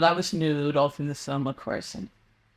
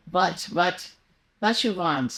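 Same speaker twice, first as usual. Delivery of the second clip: German accent; slower than usual. Shown as a demonstration. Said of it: "You make the W V".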